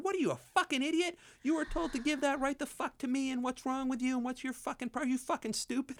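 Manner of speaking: high-pitched mock rant at a crew member; hammed-up angry director; retold director yelling. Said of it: "Are you yelling at me?".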